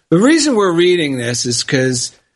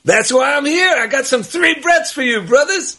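Eddie Murphy impression; 5 semitones higher than usual. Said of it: "it's Eddie Murphy".

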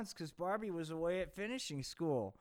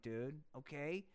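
ordinary delivery

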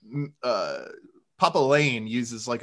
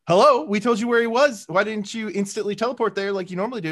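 mock indignant; 7 semitones higher than usual. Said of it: "was like".

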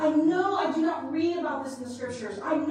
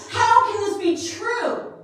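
higher, louder, slowed desperate plea; loud, high, slowed anguish; anguished cry; 10 semitones higher, 11 dB louder, slower than usual. Retold or imitated. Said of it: "but I was frantic".

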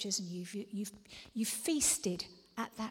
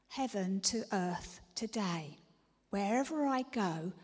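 normal talking voice

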